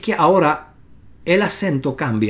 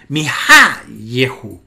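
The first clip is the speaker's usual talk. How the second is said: mi ha yehu slowly, with ha hit hard; slow, deliberate mi ha yehu, HA emphasized; stress on HA; 4 semitones lower, 4 dB louder, much slower than usual. Shown as a demonstration. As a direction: demonstrate mi ha yehu, accent on ha, yehu falling away. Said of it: "noten eso".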